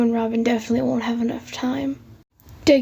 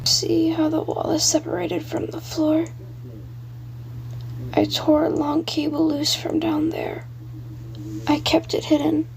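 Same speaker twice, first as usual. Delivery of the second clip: put-on dead paper boy, higher and hushed, frightened; a ghost child warning in a breathy hush, fading into whisper; haunted ghost boy; 4 semitones higher, 4 dB quieter than usual. Billy's ghost voice.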